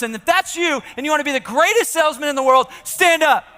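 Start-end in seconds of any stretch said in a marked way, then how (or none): 1.1-3.4 rallying shout. Real, not acted. Speaker pumping up the sales crowd, shouting orders. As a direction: fire up the room as you call out. Speaker commands the audience to stand.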